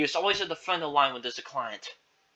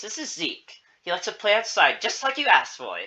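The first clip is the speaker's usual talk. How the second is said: higher, louder presenting voice; upbeat caretaker introduction; 6 semitones higher, 4 dB louder than usual. Acted. Beth introducing Zeke to Agnes, upbeat. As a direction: present the child in a lifted voice. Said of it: "Beth explained to her".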